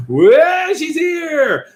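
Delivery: high, drawn-out hollered welcome; high mock fanfare call; hammed-up announcement. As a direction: announce the arrival in a high, stretched voice. High drawn-out greeting to arrival.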